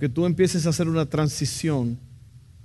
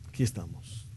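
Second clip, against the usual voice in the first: much lower, much softer, almost breathed; hushed echo; 10 semitones lower, 12 dB quieter than usual. Real, not acted. Preacher to congregation, hushed and low. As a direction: let the phrase sink in, barely voiced. Preacher echoes phrase, near whisper.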